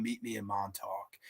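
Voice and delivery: hushed, low and very soft; retold whisper. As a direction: murmur it like a secret to a lover. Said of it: "she whispers to him".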